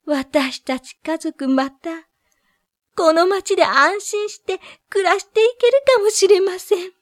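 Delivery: high, relieved townswoman; relieved woman's voice. Acted. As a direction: sigh with relief, lightly.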